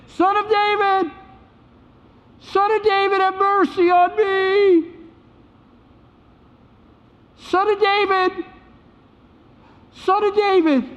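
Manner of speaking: high, sustained call from far off; crying out. Acted. Blind beggar in the background calling to Jesus, crying out. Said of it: "in the distance crying out".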